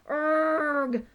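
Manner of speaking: loud high urgh of rage; frustrated growl. Acted. Poo Poo at the twitching tail, angry groan.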